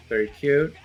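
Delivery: normal talking voice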